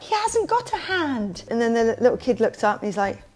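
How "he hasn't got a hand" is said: high, slow, drawn-out protest; high-pitched imitation of an upset woman; mock indignant woman. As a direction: imitate her aghast correction. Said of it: "and she was like".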